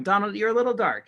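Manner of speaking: normal talking voice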